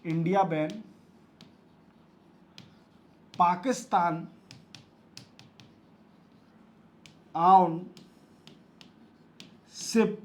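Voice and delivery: each word spaced out slowly for copying; slow dictation. Shown as a demonstration. Teacher to students taking notes, slow dictation.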